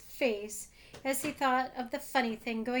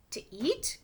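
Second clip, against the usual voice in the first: surprised; 3 semitones higher than usual. Acted. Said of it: "cried Bobo".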